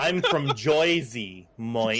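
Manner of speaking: slow, drawn-out attempt at Jersey; clumsy fake Jersey accent; bad Jersey accent. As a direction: botch a Jersey accent, stretching each word. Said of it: "His accent was better than mine".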